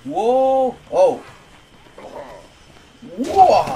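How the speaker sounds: loud, high shouts of awe; amazed shouts. Real, not acted. Sees the Brain Master boss. (gritos de asombro)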